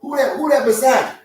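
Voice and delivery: high, loud buddy-buddy lion; chatty lion. Pretend. Lion casually quizzing Frank the zebra, high and loud. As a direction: greet your prey like an old pal.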